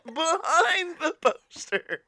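slow, laughing echo. Pretend. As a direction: repeat it through laughter. Acted discovery.

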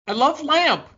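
high-pitched simpleton insisting; mock-earnest, high film-quote voice; simpleton Brick.

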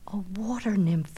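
unvoiced whisper; whispered thought. Narcissus seeing the face, whispered. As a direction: breathe it in wonder. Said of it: "he thought".

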